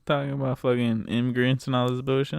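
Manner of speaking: ordinary delivery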